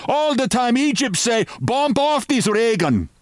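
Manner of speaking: put-on very high-pitched excited foreign accent; mock shout. A narrator's reading. Swarthy henchman clamouring for a killing, mock shouting. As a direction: cry out high without real volume. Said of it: "he shouted".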